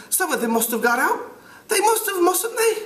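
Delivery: very high, piping British schoolboy; British accent. Acted. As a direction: play an anxious-to-be-agreed-with British boy. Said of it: "try to do it with a British accent".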